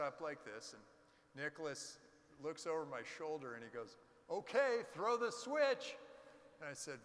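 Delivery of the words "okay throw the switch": slow, loud, high call to throw the switch; loud, drawn-out command; mock command. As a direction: boom it out like a launch order. Engineer's command in retold story.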